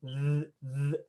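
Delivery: th- with the tongue out between teeth; tongue sticking out. Shown as a demonstration. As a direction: push "th-" out with the tongue showing. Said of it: "Your tongue is gonna come out".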